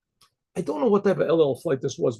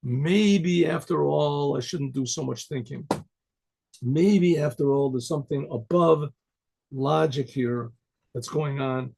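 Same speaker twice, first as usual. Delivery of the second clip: slow reconsidering; 2 semitones lower, slower than usual. Retold or imitated. Yaakov deciding to put on tefillin, slowly pondering. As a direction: weigh each thought slowly, with pauses. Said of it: "But Yaakov decided".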